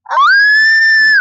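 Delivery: a long, high, loud scream; long excited scream. Real, not acted. Something pops open, a long high scream.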